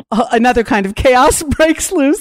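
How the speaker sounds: chuckling through the words; laughing. Real, not acted. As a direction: laugh it out as you tell it. Laughing about chaos at home.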